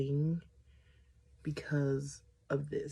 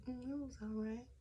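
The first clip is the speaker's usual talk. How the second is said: cool, guarded reply; 8 semitones higher, 10 dB quieter than usual. Retold or imitated. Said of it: "And I'm like".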